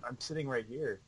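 distant, off-mic voice; far from mic. Real, not acted. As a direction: speak from across the room. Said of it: "sounds like you're far away from your microphone".

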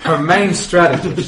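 laughing through the words. Real, not acted. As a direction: talk while cracking up. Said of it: "I thought that was a name".

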